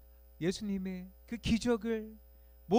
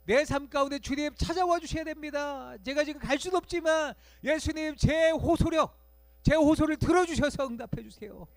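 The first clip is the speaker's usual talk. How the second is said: much higher, loud, slowing on 제 호소력; desperate cry; 9 semitones higher, 9 dB louder than usual. Pretend. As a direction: cry out to Jesus for help. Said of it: "간절히 외치고 있는 거예요". (간절히 부르짖는 외침)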